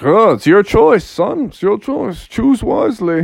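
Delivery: storybook father relenting; cautioning dad. Acted. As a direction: hand over the choice with a warning. Dad gives in with warning.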